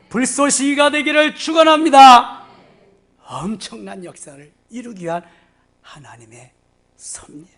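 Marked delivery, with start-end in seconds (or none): 0.1-2.4 much higher, louder fervent proclamation; climactic blessing. Real, not acted. 7.0-7.5 unvoiced hushed word; whisper. Real, not acted. Whispered conclusion.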